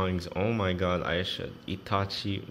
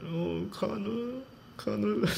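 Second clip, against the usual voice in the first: high, drawn-out delighted call of the name; excited squeal; 13 semitones higher, 4 dB quieter, slower than usual. Real, not acted. Squealing a friend's name in delight.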